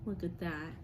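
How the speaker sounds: normal talking voice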